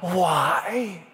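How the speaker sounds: high, drawn-out anguished "why"; acted wail of despair; crying out to God. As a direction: cry it out with the pitch swooping up. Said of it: "he cries out to God".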